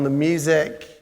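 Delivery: normal talking voice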